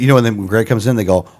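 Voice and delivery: ordinary delivery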